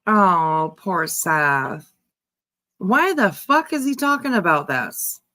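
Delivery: normal talking voice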